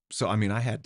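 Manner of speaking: ordinary talk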